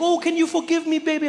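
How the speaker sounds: very high, loud begging voice; mock pleading mom. Mom to her forgotten kid, high mock pleading. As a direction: beg in an exaggerated mother voice.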